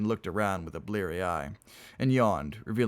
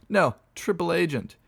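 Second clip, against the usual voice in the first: high, loud comeback; quipping; 10 semitones higher, 6 dB louder than usual. Acted. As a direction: toss out the joke loudly. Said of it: "quipped Dolt".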